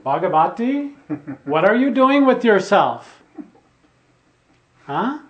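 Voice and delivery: loud, high imitation of a swami's challenge; rousing rebuke. Swami waking up his disciple Bhagavati, loud rousing rebuke. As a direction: call him out to jolt him awake. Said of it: "he was waking him up".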